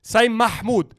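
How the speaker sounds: "Mahmoud" with a breathy Arabic h, MAH-mood; correct pronunciation. Shown as a demonstration. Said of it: "tell him to pronounce my name right".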